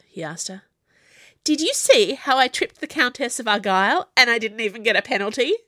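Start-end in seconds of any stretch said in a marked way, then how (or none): 1.4-5.6 loud, higher, bragging about a trick; proud. A narrator's reading. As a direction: boast it proudly, a bit louder. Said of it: "she said proudly".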